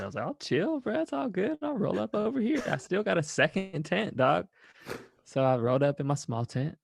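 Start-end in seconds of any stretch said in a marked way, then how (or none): 0.2-4.5 much higher, breezy backing-off voice; placating. Retold or imitated. Backing away from angry Kali, high and placating. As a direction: back away lightly, no problem.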